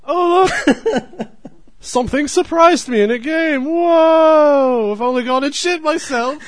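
very high, loud squeaky cartoon voice; Officer Cox. Host plays Officer Cox reacting to a game scare, shrill squeal. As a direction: squeal it out like a startled cartoon cop. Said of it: "All right, Officer Cox".

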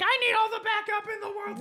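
high, fast panicked plea; suddenly desperate for backup; panicked cop. Retold or imitated. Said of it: "it changes his tune really quick".

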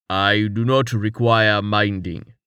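slow, dignified denial; a hint of a stiff giant, slow; Tallheart, slow and solemn. A narrator's reading. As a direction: Tallheart answering Jameis, slow and grave. Tallheart voiced.

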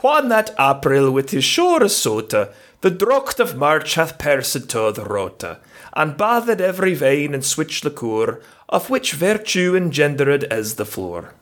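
slow Middle English Chaucer recital. Shown as a demonstration. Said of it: "Just a couple lines from the beginning".

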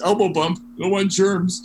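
lightly acted, lifted-pitch quote; mock quip. Acting out someone's quip.

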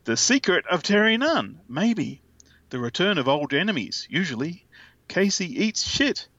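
lightly dramatic reading. Acted. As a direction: read the promo text with flair. Reads solicitation text aloud.

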